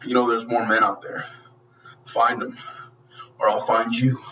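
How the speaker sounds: low action-hero threat with long pauses; Rambo. Retold or imitated. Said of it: "and then Rambo goes".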